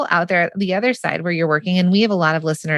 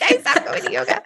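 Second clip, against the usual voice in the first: high, giggly mock excuse; sheepish laughing joke about skipping yoga; laughing quip; 14 semitones higher than usual. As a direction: laugh through the confession.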